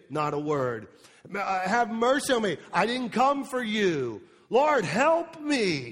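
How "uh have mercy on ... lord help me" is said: desperate begging. Retold or imitated. Woman begging, retold.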